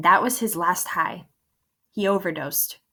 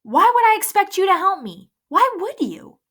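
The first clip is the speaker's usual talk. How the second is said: loud, higher, bitter and hurt; angry outburst; 12 semitones higher, 7 dB louder than usual. A narrator's reading. Ghost lashing out at Coach, loud and hurt. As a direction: keep lashing out at him.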